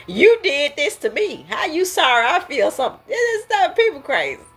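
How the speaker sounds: hammed-up outraged complaint; mock indignant blame. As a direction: play the wronged one who flips the blame. Voicing a blaming person.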